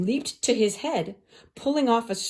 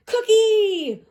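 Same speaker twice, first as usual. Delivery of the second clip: high, loud excited yell; children's shout; 7 dB louder than usual. A narrator's reading. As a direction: shout it across the playground.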